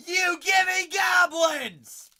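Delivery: drawn-out, high, loud shout; hype shout. Real, not acted. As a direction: yell it out in triumph. Hyped callout during break.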